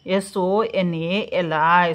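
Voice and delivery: slow, letter by letter; slow spelling. Shown as a demonstration. Teacher spelling the name Sonali aloud, slowly. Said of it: "हे जोडून घ्यायचे".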